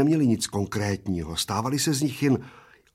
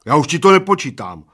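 loud, raised, obstinate announcement; stubborn; 5 semitones higher, 10 dB louder than usual. A narrator's reading. Bald man refusing to time friend, stubborn. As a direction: dig in and declare it. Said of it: "oznámil plešatec umíněně".

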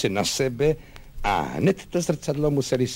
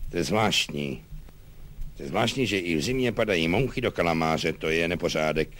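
lightly voiced musing commander; lower, absent-minded muttering with pauses; distracted colonel; 6 semitones lower than usual. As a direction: drift off from complaint into idle musing. Colonel wanders to another topic.

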